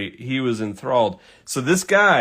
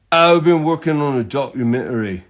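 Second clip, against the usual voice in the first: imitated Englishman, badly done; mock English accent; terrible English accent; 3 semitones higher than usual. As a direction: put on a rough English accent. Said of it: "do my terrible English accent for this".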